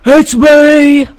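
very high, loud squeal; excited shout. Real, not acted. Player butting into a friend's video intro, excited shout.

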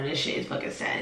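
whispered under her breath. Real, not acted. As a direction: whisper it to yourself. Sad reaction muttered under breath.

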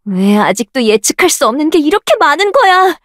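ordinary talk

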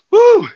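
very high, loud, held "woo"; big workout whoop. Real, not acted. Instructor during the last rep, big whoop.

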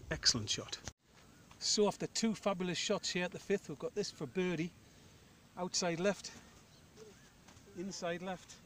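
loud TV golf commentary voice; golf commentator. Acted. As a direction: call it like a broadcast announcer. Narrates his own shot like TV.

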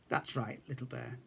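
tender reassurance. Acted. Big Bear tender reassurance.

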